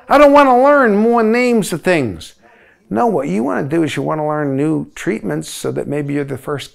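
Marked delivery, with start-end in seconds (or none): 0.1-2.3 mock student whine, louder and higher; whining student. Lecturer voicing a student who refuses new drug names, mock whine. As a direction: complain like a tired student.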